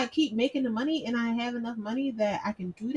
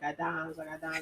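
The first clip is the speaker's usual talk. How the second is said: corner dealer call; 5 semitones lower, 8 dB quieter than usual. Retold or imitated.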